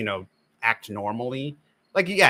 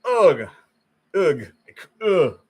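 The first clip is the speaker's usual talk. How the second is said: loud, higher groans of disgust, each cut short; 5 semitones higher, 7 dB louder, much slower than usual. Retold or imitated. Viewer watching a cringey dubbed scene, loud disgusted groans. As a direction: groan as if you can't bear to watch. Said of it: "and you're just like".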